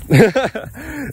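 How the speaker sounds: short high-pitched laugh. Real, not acted. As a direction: burst out laughing. Laugh after mock advice.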